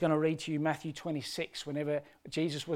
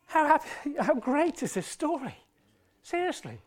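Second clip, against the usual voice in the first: getting emotional; 10 semitones higher than usual. Real, not acted. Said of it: "I actually have no idea why I'm getting emotional".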